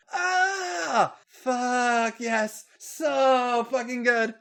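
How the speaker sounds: high, loud, drawn-out cries; acted high-pitched yell. A narrator's reading. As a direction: cry it out, stretching the words. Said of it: "Becky yelled".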